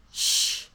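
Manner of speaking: long hushing "shh"; imitated shush. Podcaster re-enacts stylist shushing a bridesmaid, imitated shush. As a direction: shush someone, imitating him. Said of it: "He shushes her".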